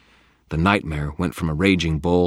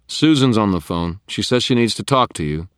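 raised, loud message; calling out; 7 semitones higher, 8 dB louder than usual. A narrator's reading. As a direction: shout word through to another room. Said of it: "Michael called".

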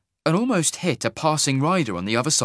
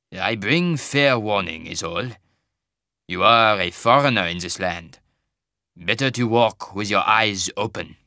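put-on slow, heavy Tarbanese accent; thick Tarbanese accent. Wagon master warning a traveller, in a thick Tarbanese accent. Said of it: "in a thick Tarbanese accent".

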